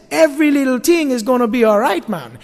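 laid-back Jamaican lilt on "Every little thing is gonna be all right, man"; Jamaican accent. Retold or imitated. Pastor voicing God in a Jamaican accent, relaxed and reassuring. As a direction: croon it easy, Jamaican style. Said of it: "He says it like that in Jamaica".

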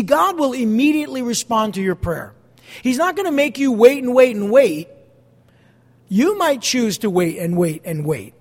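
ordinary talk, nothing marked

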